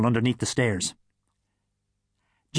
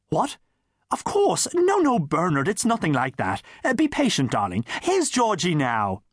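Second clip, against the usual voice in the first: theatrical mother; 5 semitones higher than usual. Acted. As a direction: fuss over him like a stage diva. Theatrical mother reassures son.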